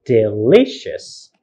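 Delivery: "Delicious" slowly, leaning on a long LI; second-syllable stress. Shown as a demonstration. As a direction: stretch and stress the middle of "Delicious". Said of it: "Second syllable".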